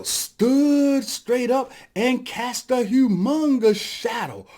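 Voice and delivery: dramatic emphasis. A narrator's reading. Storyteller showing whale looming over rabbit, slow dramatic emphasis. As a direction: stretch the words to show enormous size.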